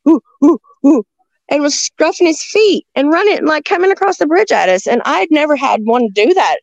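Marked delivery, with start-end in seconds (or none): 0.1-1.0 three short, loud hoots; high, punchy "ooh" calls; imitated Bigfoot hooting. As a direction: imitate an ape-like creature's whoops. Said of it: "he had his arms up like".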